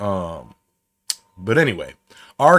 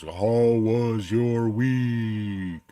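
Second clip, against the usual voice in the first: very slow, drawn-out echoing announcer read; echoey show-host voice; much slower than usual. Shown as a demonstration. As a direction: stretch every word like an old kids' TV show title. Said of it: "the big old echoey deep voice".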